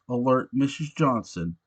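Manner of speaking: usual speaking voice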